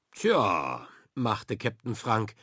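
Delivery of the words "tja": a low, almost triumphant Tja. A narrator's reading. Captain answers his daughter's doubt, almost triumphant. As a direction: sound nearly triumphant about it. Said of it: "und es klang fast triumphierend".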